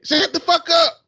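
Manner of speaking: high, loud mock yell at the dog. Host mimics cohost yelling at her dog, high and loud mock shout. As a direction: imitate her snapping at her dog, hammed-up. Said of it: "Yelling at his ass".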